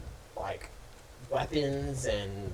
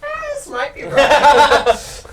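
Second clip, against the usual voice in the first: laughing; 14 semitones higher, 15 dB louder, slower than usual. Real, not acted. GM laughs at near roll.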